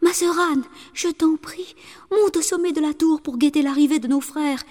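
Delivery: frightened wife begging her sister; wife calling her sister. A narrator's reading. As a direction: plead urgently with Anne. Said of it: "elle appela sa sœur aînée".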